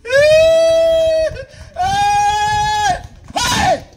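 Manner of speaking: loud, high cry out; shout. Acted. Blind man shouting after Jesus, loud high shout. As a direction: yell out to get Jesus's attention.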